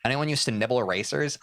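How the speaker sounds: usual speaking voice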